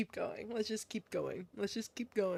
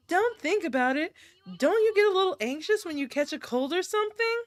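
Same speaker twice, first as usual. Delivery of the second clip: put-on higher-pitched girlish voice; coaxing girl character; 4 semitones higher, faster than usual. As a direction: coax a shy friend in a higher voice. Same character reassures.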